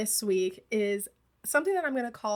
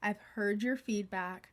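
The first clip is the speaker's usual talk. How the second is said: normal talking voice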